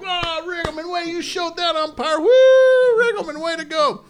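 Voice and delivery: high voiced crowd cheer; mock adoring fan praise; lightly voiced fan cheering the manager. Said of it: "The Wrigley faithful are just like".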